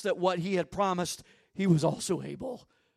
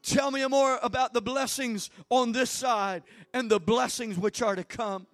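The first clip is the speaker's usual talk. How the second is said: loud, higher crying out to God; shouted prayer; 6 semitones higher, 9 dB louder than usual. Real, not acted. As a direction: pray at full force. Prayer at full force.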